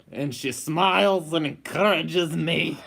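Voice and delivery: hammed-up Russian accent; husband doing a silly Russian accent for his wife. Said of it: "[name] has to do this in a Russian accent".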